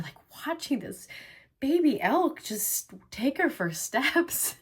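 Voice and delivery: tearful, high-pitched voice welling with emotion; choked up. Real, not acted. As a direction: tell it through real tears, voice lifted. Moved recalling sacred moment with her children.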